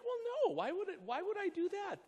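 high, baffled why would I; puzzled reply. Retold or imitated. Pastor baffled by Tyron's advice, pitched high. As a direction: sound honestly puzzled by the idea.

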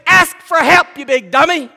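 high, loud teasing scold; mock his wife chiding him. Wife scolding him to ask for help, teasing. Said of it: "I could hear her say".

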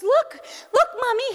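put-on high, eager little child voice; proud little boy. Three-year-old son showing mommy the cleaned-up Legos, high proud child voice.

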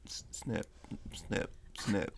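whispered scissor snip sounds; ASMR scissor snips. Shown as a demonstration. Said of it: "A S M R hair salon".

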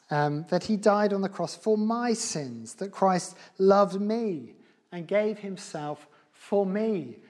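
ordinary talk

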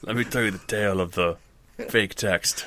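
lightly played grandpa spinning a yarn; hint of an old man telling his tale; rambling grandpa story. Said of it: "this old grandfather".

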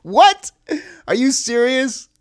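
high, loud shocked what; disbelief. Real, not acted. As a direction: gasp it out in disbelief. Disbelief at the film.

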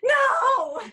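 a high, loud "No!" shouted in protest; retold shout. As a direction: yell it high and loud in refusal. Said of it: "Alex went".

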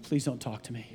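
put-on low, soft grumbling teenage mumble; mumbled teen brush-off. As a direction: mimic a sullen son muttering him away. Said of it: "He's like".

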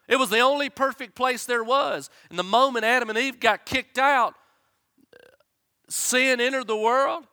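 loud, high fervent preaching; preaching at full force. Acted. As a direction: preach it out with rising fire.

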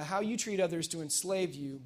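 normal talking voice

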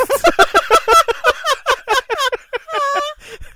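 a long, loud, high shriek of laughter; cackle, loud and high, out of control. Real, not acted. Cracking up at her own joke, loud high shriek.

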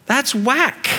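"whack" shoots up high, mock disbelief; incredulous Jesus. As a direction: play Jesus scoffing in disbelief. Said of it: "Jesus is looking at you saying".

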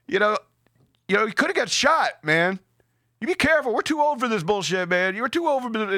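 high-pitched imitated warning; admonishing driver. Acts out driver warning the other.

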